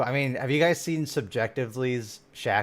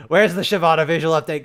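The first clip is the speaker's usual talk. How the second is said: louder, impatient repeat of the question; louder exasperated demand; 4 semitones higher, 7 dB louder than usual. Real, not acted. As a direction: demand it again, fed up. Streamer complaining about missing update.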